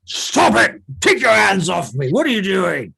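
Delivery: put-on high, loud outraged old man; furious old man shouting back; angry old man. Old man dragged, protests.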